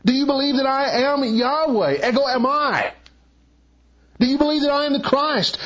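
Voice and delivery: high-pitched, insistent preaching rising to a peak; impassioned preaching. Acted. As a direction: press each question home with rising fervor. Preacher builds to a climax.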